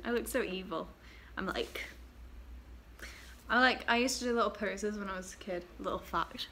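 ordinary talk

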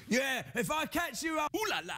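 very high, shouted mock threat; hammed-up high-pitched taunt; retold taunting threat. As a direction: imitate a man yelling threats as he escapes.

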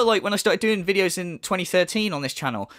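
ordinary talk

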